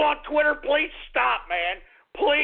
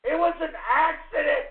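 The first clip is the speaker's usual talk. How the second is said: normal talking voice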